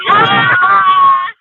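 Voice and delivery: loud excited cry; excited shout. Real, not acted. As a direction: cry out in delight.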